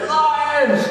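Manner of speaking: high-pitched herd warning cry; mock alarm. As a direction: cry out in comic panic. Wildebeest herd sounds the alarm.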